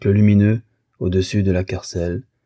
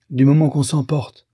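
higher, affronted declaration; offended huff; 7 semitones higher, 3 dB louder than usual. A narrator's reading. Husband looks for his hat, offended and huffy. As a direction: break off the quarrel in a huff.

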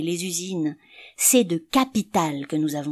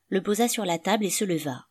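plain reading voice

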